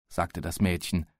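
ordinary delivery

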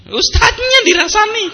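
high shouted exclamation in mock shock; mock outraged shout. Preacher to congregation, gossip reaches the ustadz, mock outraged shout. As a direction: cry it out, scandalised.

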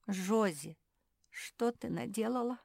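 mildly tearful reproach. A narrator's reading. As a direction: lament it with a touch of crying. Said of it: "заплакал Фил".

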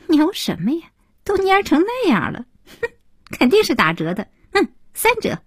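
put-on high-pitched, scornful young girl's jeer; parting taunt. A narrator's reading. Girl leaving the elevator mocks a woman's wilted roses, scornful. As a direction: toss it out mockingly in a high girlish voice. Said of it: "一个女孩走前甩下一句".

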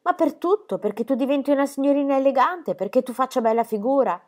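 high, loud exasperated grandmother; exasperated scolding. A narrator's reading. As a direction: scold her, fed up.

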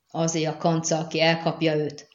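usual speaking voice

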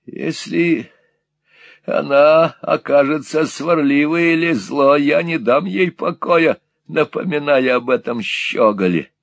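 put-on high, slow spiteful old man; old miser musing spitefully. A narrator's reading. Gride alone plotting against his bride, spiteful high. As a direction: plot it aloud with malice. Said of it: "сказал он, когда пришёл в себя".

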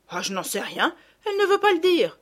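mild exasperation, jumping high and loud at the end; lightly voiced frustrated country mother; exasperated peasant gardener. A narrator's reading. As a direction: throw up your hands as a baffled countrywoman.

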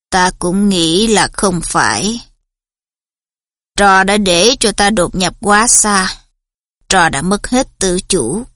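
low, cold and measured teacher; stern Snape voice. A narrator's reading. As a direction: speak down, cold and slow. Snape voice held.

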